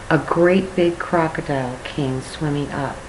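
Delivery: ordinary delivery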